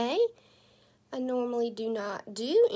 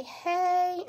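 cheery hey; 9 semitones higher than usual. Shown as a demonstration. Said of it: "So y'all say".

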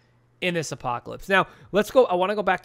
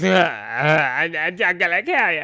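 imitating crying kid; 3 dB louder than usual. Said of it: "He's like".

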